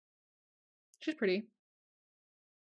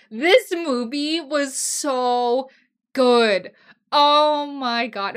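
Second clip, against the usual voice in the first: loud, drawn-out rave; slow, heartfelt gush at full volume; emphatic gushing; 4 semitones higher, 8 dB louder, much slower than usual. Real, not acted. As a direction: stretch every word out with fan enthusiasm. Gushing over a beloved movie.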